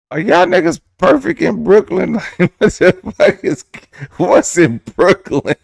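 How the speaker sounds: high, loud incredulous squawk; mock outrage. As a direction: act scandalized at Brooklyn pride.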